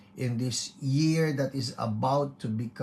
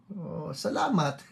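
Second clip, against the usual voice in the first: ordinary talk, nothing marked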